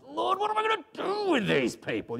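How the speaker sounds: high, strained cry of exasperation; frustrated cry. Acted. Preacher as Moses crying to God about his people, frustrated. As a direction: cry out at the end of your tether. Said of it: "you can hear the frustration in his voice".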